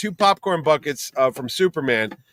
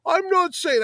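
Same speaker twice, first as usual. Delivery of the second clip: high-pitched mock politician's dodge; Mister Political; slower than usual. Friend going cagey once recording starts, mock political voice. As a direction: imitate an evasive politician, pitched way up. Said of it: "he suddenly became Mister Political".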